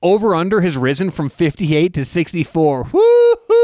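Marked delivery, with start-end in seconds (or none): 2.9-3.6 loud, high woo-hoo; big high-pitched cheer; excited whoop. Real, not acted. Whooping at the rising over-under.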